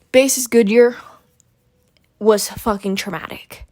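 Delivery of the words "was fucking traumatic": normal talking voice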